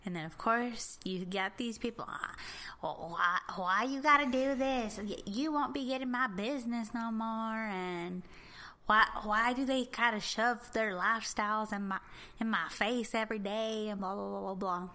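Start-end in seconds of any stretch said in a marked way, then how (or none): 2.1-7.6 put-on Southern accent. Imitating Facebook complainers about Pride posts, Southern accent. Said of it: "I'm doing a Southern accent". 8.9-13.9 mock Southern complainer; Southern accent. Imitating people griping about lifestyles, Southern accent. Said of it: "I'm doing a Southern accent".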